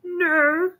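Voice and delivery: very high firm frog no. Acted. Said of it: "said the frog".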